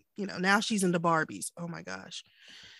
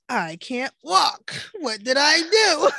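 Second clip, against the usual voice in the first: slow, drawn-out exclamation building louder and higher; mock dismay; 5 semitones higher, 7 dB louder, slower than usual. Retelling getting up with a bad back, mock dismay. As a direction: exaggerate the baffled complaint, stretching the words.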